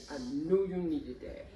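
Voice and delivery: quiet knowing reassurance; a friend tenderly saying he knew; gentle. Retold or imitated. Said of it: "He said".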